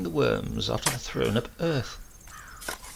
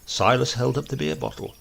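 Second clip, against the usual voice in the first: usual speaking voice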